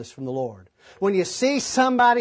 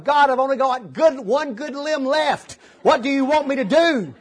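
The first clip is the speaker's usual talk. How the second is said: pleading cry pitched higher, dragging on one good limb; crying out to God; 7 semitones higher, 4 dB louder than usual. Retold or imitated. Preacher retelling how he begged God, desperate cry. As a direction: lift it up to God like a desperate plea. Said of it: "I'm crying out to God going".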